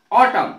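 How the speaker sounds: ऑटम ending on M, N left silent; silent final N. Shown as a demonstration. Teacher modelling ऑटम for students, N silent. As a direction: say ऑटम loudly, closing on the M. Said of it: "इसकी उच्चारण ऑटम है".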